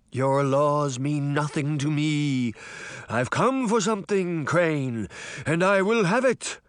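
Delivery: drawn-out, imperious spectre voice; bellowing phantom. A narrator's reading. Sabrina as the Horseman threatening Crane, bellowing. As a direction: bellow it out like a menacing ghost. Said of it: "Sabrina bellowed".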